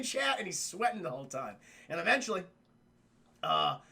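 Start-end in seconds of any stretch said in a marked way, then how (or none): none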